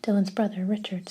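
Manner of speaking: plain reading voice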